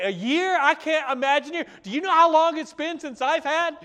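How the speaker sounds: high, loud shocked protest; incredulous outburst. Retold or imitated. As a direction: balk at the idea in disbelief. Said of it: "And you say".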